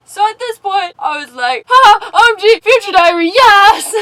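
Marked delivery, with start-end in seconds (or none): 1.7-3.9 loud, high fangirl squeal; half-crying, thrilled yell of recognition; excited shriek. Retold or imitated. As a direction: shriek it with delight. Fangirl reaction to anime reference.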